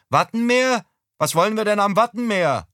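high, loud puzzled call from afar; mother calling. Acted. Mother mishears her husband from the kitchen, high puzzled call.

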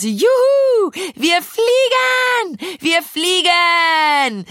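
very high, long-drawn whooping; jubilant whoop. A narrator's reading. Carolina pretends to fly on the tandem, jubilant whooping. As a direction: whoop with glee, stretching every word out. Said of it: "stieß ein lautes Juchzen aus".

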